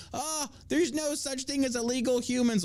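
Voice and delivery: high, mincing mock liberal voice; mocking virtue signaler. Host mocking liberals' virtue signaling, in a high prissy voice. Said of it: "Everybody was virtue signaling".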